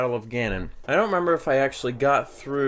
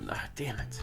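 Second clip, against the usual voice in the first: whispered curse; 12 dB quieter than usual. Real, not acted. Muttered curse after slip.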